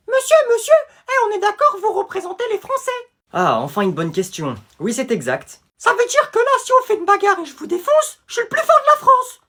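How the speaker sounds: schoolboy questioning the president, excited; squeaky kid voice. Acted. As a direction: play an eager schoolkid. Child voice asking president.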